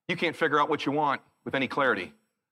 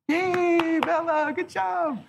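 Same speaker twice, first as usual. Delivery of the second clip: cheery parent praise; 12 semitones higher, much slower than usual. Retold or imitated. Parent praising shy child.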